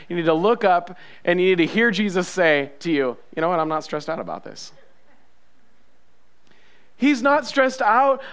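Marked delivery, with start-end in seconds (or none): 3.3-4.7 soft, low, unbothered aside; gentle, lower voice, totally unworried; calm reassurance. Acted. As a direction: quietly settle a stressed listener. Jesus calmly reassuring the stressed listener.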